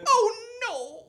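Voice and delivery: high mock dismay; grandma gasp. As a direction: fake alarm like grandma. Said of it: "And then she'd be like".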